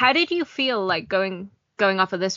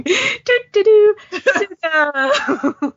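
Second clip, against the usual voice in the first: high, slow sing-song through laughter; drawn-out, loud, high mock theme tune; playful tootling imitation; 12 semitones higher, 8 dB louder, much slower than usual. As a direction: tootle along like a tiny comic airship. Imitates tiny airship tootling along.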